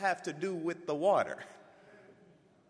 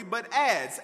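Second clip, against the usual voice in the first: normal talking voice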